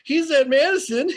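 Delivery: retold drummers' answer, laughing; laughing quote. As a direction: push the words out through laughter. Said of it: "And they went".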